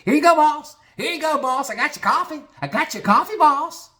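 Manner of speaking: very high, squealed eagerness; mock suck-up proudly delivering to the boss; fawning Bobby. Bobby presenting coffee to boss.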